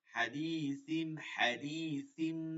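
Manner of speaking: হাদিসিম drawn out slowly, ending on a nasal ম; slow recitation. Shown as a demonstration. Teacher drills the iqlab example for students. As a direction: stretch হাদিসিম very slowly, humming the ম. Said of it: "সিন হবে না".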